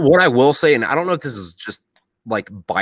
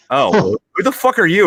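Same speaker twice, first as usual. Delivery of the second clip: much higher, louder puzzled outburst; the old Afghan man caught off guard; mock baffled villager; 11 semitones higher, 6 dB louder than usual. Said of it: "And he's like".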